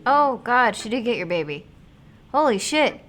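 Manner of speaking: high, loud stunned cry; shocked outburst. Real, not acted. Streamer reacting to the witch taking the baby, loud shocked cry. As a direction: reel at the twist.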